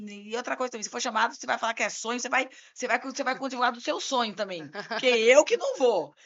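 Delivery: loud, retold telling-off; exasperated scolding. Lawyer refusing to defend client's dream story, loud exasperation. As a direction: build to an emphatic loud refusal.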